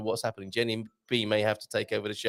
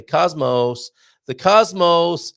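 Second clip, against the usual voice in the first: cosmos said the American way, "cosmos" with a flat open o, louder and slow; mock American "the cosmos", drawn out; American accent; 5 semitones higher, 8 dB louder, much slower than usual. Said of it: "Or as you Americans say".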